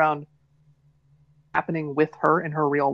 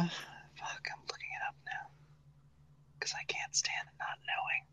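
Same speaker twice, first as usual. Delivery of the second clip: whisper; 19 dB quieter than usual. Real, not acted. Muttering while searching online.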